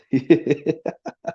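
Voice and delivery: short real chuckle; laugh. Real, not acted. Teasing Mukit about hurt feelings, amused chuckle. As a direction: chuckle briefly after the teasing.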